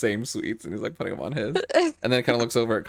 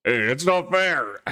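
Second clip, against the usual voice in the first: drawn-out, mildly whiny complaint; mock protest; 5 semitones higher than usual. Huffing runner complains after losing the race, mock protest. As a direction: cry foul slowly.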